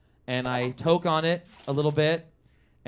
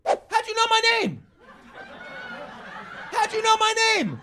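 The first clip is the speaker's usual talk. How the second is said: hammed-up paranoid shock; alarmed demand. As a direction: demand it in shocked suspicion, pitched way up. Dealer startled at hearing his name.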